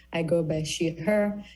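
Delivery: ordinary talk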